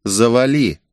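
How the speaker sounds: ordinary delivery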